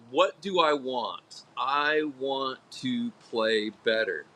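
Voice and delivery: slow, steady "I want to play better"; slow, sincere self-talk; slow affirmation. Shown as a demonstration. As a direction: say it calmly, meaning every word. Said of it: "far more productive to say".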